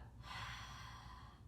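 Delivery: long breathy open-mouth exhale; slow audible exhale; H sound breath. Shown as a demonstration. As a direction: fog the mirror with an audible haah. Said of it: "make that H sound".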